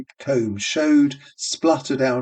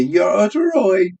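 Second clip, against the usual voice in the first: high, louder giggly tease; giggling; 8 semitones higher, 5 dB louder than usual. A narrator's reading. Two barmaids giggling over the old man's eye. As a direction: toss it off in a peal of giggles. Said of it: "In a giggling peal".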